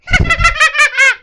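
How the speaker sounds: high shrieking witch laugh; witch cackle. Acted. Cackle after witch line.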